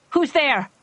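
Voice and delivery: loud, high call into the dark; frightened cry. Acted. Doctor calling to unseen shooter, loud frightened cry. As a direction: call out scared and searching. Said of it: "she cried".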